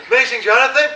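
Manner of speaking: surprised street greeting, retold; excited fan. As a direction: imitate a fan recognising a celebrity.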